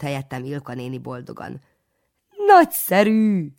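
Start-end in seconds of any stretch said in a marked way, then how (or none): 2.5-3.5 high, loud, slow jovial cry; hearty exclaim. A narrator's reading. Jovial priest exclaims his favourite word, high and loud. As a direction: bellow it with delight.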